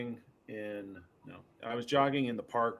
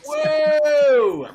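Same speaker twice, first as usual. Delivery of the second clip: long, high, loud whoa; shocked shout; 13 dB louder than usual. Real, not acted. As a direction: cry out in amazed shock. Reacting to the shocking ad.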